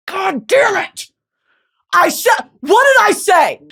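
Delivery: high, loud mock anguish; groaning God damn it, hammed up; frustrated outburst. Told he's up, she cries out in mock despair. Retold frustration at being chosen.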